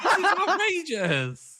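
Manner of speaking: high, slow, laughing through the words; laughing disbelief. Real, not acted. Cohost laughing at the absurd movie plot, laughing outrage. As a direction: crack up mid-complaint.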